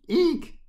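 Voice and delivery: very high, loud "eek"; short high shriek of fright; startled squeal. A narrator's reading. Bat flies across her face.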